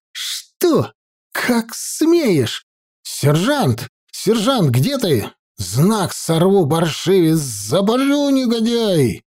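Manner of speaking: high, spitting fury; raging. A narrator's reading. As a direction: spit the curses out in a rage. Knight rages at necromancer.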